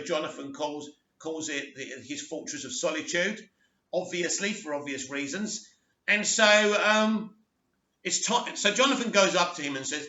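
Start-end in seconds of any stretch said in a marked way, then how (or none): none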